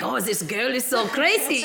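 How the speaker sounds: playful caricature of a German; mock German accent. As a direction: imitate a baffled German with a thick accent. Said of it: "you know, the Germans, like".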